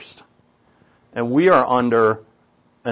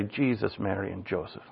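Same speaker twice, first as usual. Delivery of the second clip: very soft, low mock exasperation; hushed, sinking maternal oath; mad mom imitation; 4 semitones lower, 13 dB quieter than usual. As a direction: mimic a cross mother under your breath. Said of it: "But when she'd get mad".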